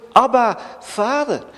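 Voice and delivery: pleading loud call. Acted. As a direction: cry out to God as a child. Crying out to the Father.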